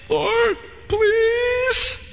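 high pleading cry of Lord please; whiny high plea for mercy; begging sinner. Acted. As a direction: beg God like a desperate sinner.

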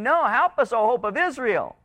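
high-pitched plea from a preacher; crying out for help. Acted. Israel begs God for help, crying out. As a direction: plead upward as a desperate people. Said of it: "they're crying out".

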